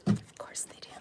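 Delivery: hushed answer to her own question; whispered aside. Real, not acted.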